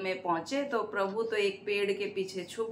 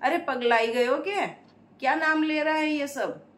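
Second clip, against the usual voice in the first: high, louder rebuke; loud scolding; 5 semitones higher, 4 dB louder, faster than usual. Acted. Lakshmi shouting at the cowherd up close, loud scolding. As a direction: shout at him to stop. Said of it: "जोर से लगाया उसे".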